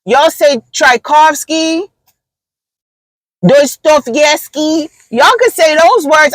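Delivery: high, slow, pointed comeback. Retold or imitated. Telling coworkers they can say Russian names, slow and high. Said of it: "And then I said".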